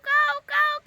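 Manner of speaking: high mock crow caw kaavu kaavu. Wife mimicking the crow outside her house, high caw. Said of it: "కావు అంటుంది".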